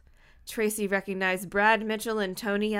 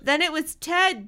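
higher-pitched accusation; raised, pointed declaring; emphatic declaration; 6 semitones higher, 4 dB louder than usual. A narrator's reading. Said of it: "Dina declared".

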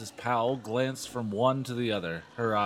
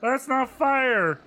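high, loud, slow whine; hammed-up protesting girl; 14 semitones higher, 7 dB louder, much slower than usual. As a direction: kid declaring unfairness. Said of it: "Lindy declared".

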